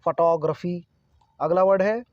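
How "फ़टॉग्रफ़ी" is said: "Photography" as fuh-TAW-gruh-fi; stress on TAW. Shown as a demonstration. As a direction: lean on the second syllable of "photography", TAW. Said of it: "किस word पर हम जोर देंगे". ("Photography" में "टॉ" पर ज़ोर, फ-टॉ-ग्र-फी)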